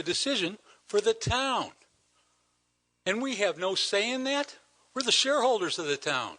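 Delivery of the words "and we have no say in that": ordinary talk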